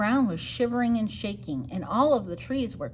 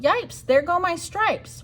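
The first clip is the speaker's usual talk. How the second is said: higher, slow mock yell; zebra yell; 8 semitones higher, 4 dB louder, slower than usual. Zebra losing stripes, hammed-up yell. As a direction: cry out in dismay. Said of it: "The zebra yelled".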